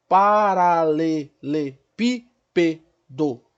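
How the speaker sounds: "Paralelepípedo" muito devagar, em sete sílabas separadas; separando sílabas. Shown as a demonstration. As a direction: conte as batidas de "Paralelepípedo", alongando o início. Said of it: "Eu tenho sete sílabas". ("Paralelepípedo" bem silabado, com pausas)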